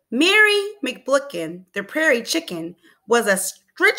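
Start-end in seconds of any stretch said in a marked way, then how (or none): none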